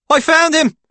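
high, loud triumphant call; excited high-pitched holler. A narrator's reading. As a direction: shout the news to the next room. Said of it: "Paul calls".

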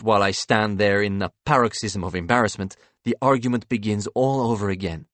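plain reading voice

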